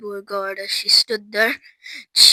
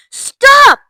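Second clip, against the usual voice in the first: high, loud command to halt; urgent cry; 13 dB louder than usual. A narrator's reading. Mister Wonka to Violet chewing the gum, urgent shout.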